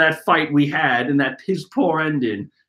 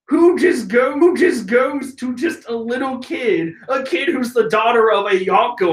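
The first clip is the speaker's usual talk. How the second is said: usual speaking voice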